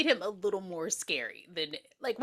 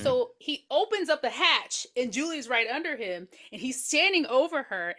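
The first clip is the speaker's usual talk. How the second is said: ordinary delivery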